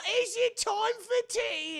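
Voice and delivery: put-on very high, slow old rat voice; ancient cabin boy. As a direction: play a doddering mummified rat. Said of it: "incredibly old mummified rat".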